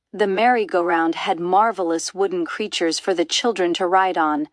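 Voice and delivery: ordinary delivery, nothing marked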